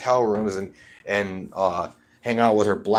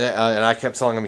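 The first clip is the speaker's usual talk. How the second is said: ordinary delivery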